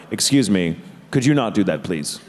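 low, firm official request; very serious voice. Retold or imitated. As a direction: lay down the law politely, pitched low. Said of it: "In a very serious voice".

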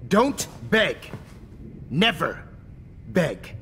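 scornful burst of anger; sharp rebuke. Acted. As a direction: cut the begging off with contempt. Snaps at begging captive.

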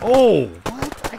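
startled high yelp; sudden shocked outburst; surprised. Real, not acted. Sudden kill surprise in game.

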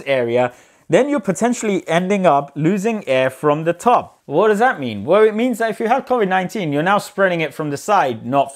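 muffled behind surgical mask; speaking through cloth mask; through a face mask. Real, not acted. Seen 0: Surgical mask covers his mouth and nose throughout the labelled words.

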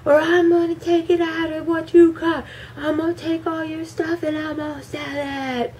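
mock angry dad. Dad scolding daughter over the windshield, hammed-up rage. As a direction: yell like the furious father. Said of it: "he was screaming at her".

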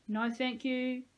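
mocking echo. A narrator's reading. Peyton parroting Dean's refusal, mocking echo. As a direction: mimic the polite refusal to shame him.

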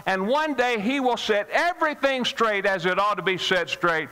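preacher rising to full fervor; building sermon climax. Acted. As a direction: drive it home to the congregation.

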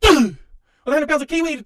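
ordinary talk, nothing marked

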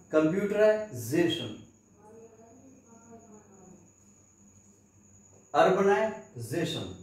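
कंप्यूटराइजेशन slowly with a z sound; drawn-out -zation endings. Shown as a demonstration. Teacher drilling -zation words slowly. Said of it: "तो जैसे".

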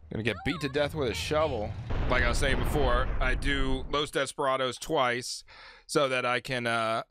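normal talking voice

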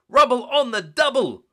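high, loud puppy shout. Acted. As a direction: bellow it out like an eager pup. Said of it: "Rubble shouted".

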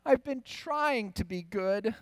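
put-on slow, high, pleading child; a small girl confessing earnestly; earnest little girl. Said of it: "And Josephine says".

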